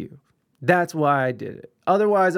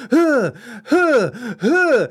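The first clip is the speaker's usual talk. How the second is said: mock grunts; 7 semitones higher, 5 dB louder, much slower than usual. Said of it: "So I go".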